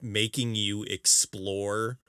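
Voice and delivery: ordinary talk, nothing marked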